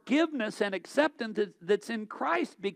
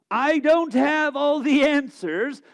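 louder, slow and ringing proclamation; emphatic declaration; 7 semitones higher, 9 dB louder than usual. Real, not acted. Pastor happily heralding to the congregation, loud and slow. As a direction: proclaim it proudly, drawing it out. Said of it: "I happily herald".

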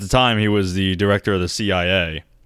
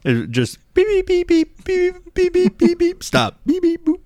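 high-pitched beep beep imitation. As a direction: chirp out the beeps like a machine. Imitating old telegraph beeps.

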